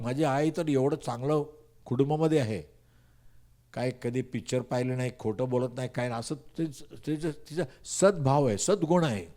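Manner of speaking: usual speaking voice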